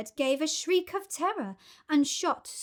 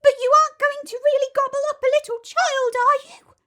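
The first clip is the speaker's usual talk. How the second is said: high, fast pleading monkey; horrified monkey; 11 dB louder, faster than usual. Acted. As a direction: beg in a frightened squeak.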